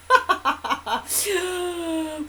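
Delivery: bursting laugh; real laugh. Real, not acted. Mum telling a funny story, real laugh. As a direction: break into a laugh.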